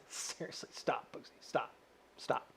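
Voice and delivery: whispered to himself; whispering seriously to his own alter ego. Pretend. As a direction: hush the sidekick under your breath. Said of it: "I'm talking to myself".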